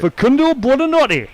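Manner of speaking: put-on name said in a Geordie accent. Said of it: "let's hear the Geordie accent".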